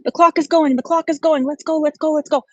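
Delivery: high-pitched, rapid-fire mock auctioneer pestering; pushy live-sale hype, imitated without real screaming; screaming seller. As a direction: hound the bidders in a relentless high-pitched chant. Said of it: "when they're screaming at them".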